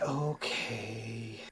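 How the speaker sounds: long, soft sighed okay; reluctant, quiet sighing agreement; resigned sigh. Acted. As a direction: sigh it out, drawn-out and low in energy. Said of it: "They give a sigh".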